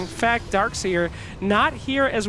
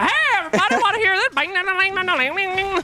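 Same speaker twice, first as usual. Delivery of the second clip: higher, hammy hillbilly banjo player; banjo guy; 8 semitones higher, faster than usual. Retold or imitated. Mocking caster imitating a banjo guy crashing a violin school, hammy. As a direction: ham up a rube showing off his banjo. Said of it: "he's like".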